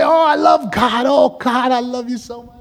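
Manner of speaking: high, overdone "Oh, I love God" that swells then drops to a hush; mock gushing churchgoer. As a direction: parody a showy worshipper, half crying out, half sighing. Mocking showy churchgoers' gushing.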